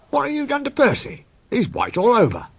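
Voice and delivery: very high, surprised railwayman; a startled signalman squeaks the question. Acted. Signalman to Percy's driver, high surprised voice.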